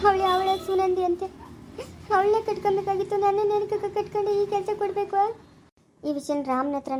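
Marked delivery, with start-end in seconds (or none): 0.1-5.2 crying. Acted. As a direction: complain bitterly while weeping. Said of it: "ನೀನ್ ಅಳ್ಬೇಡ, ಸುಮ್ನೆ ಇರು". (ಗಂಡನ ಬಗ್ಗೆ ದೂರುತ್ತಾ ಅಳುವ ಹೆಂಡತಿ)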